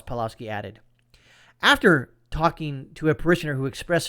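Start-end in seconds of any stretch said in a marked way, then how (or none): none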